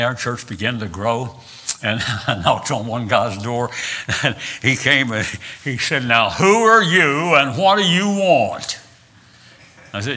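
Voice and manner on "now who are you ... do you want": raised, louder voice, slow and demanding; mock suspicious challenge. Man answering his door to an unknown preacher, acted suspicious challenge. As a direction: imitate a wary householder challenging a caller.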